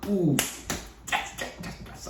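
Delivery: breathy hushed yes yes yes, as if eagerly waiting; eager mock whisper. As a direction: whisper the yeses in secret glee. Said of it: "And I was just like".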